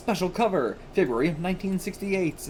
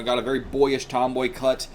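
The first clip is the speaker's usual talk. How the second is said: normal talking voice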